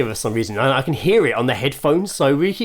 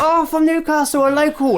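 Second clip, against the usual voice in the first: put-on Northern girls; 14 semitones higher than usual. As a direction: coo it in a hammed-up Northern accent. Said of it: "they were like".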